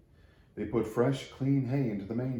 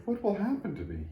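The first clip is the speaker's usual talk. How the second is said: worried little tree; 11 semitones higher than usual. Acted.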